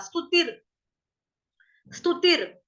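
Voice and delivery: स्तुतिर with a rolled र after ती. Shown as a demonstration. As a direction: add the रफार र right after ती in स्तुतिर. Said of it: "उसका उच्चारण आप लोग करोगे ती के बाद".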